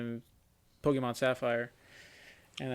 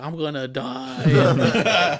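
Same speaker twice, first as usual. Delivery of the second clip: drawn out very slowly, louder, laughing; mock childish wail; 2 semitones higher, 10 dB louder, much slower than usual. Telling mom at night he will die, mock wail. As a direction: stretch it like a wailing kid, half laughing.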